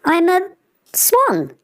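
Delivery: wide-eyed discovery of himself; surprised. Acted. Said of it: "You seem surprised".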